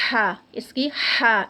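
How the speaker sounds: ہا with a slow, breathy throaty h; breathy throat ha. Shown as a demonstration. Teacher showing the sound of the throat ha. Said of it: "اس طرح کی sound آئے گی".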